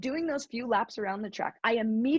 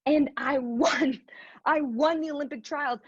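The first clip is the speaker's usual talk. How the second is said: choked up; 3 semitones higher than usual. Real, not acted. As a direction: let the tears through on the win. Winning the Olympic trials.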